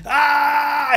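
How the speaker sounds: high cry of pain; mock pain cry. Seiko's ribs crushed, high mock cry. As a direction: yelp as if ribs are crushed. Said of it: "on voit Seiko qui crie".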